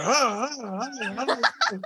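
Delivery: high laughing ahs while spun; dwarf spun in a circle, giggling; giddy dwarf. Acted. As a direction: squeal happily as you're swung around. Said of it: "he's like".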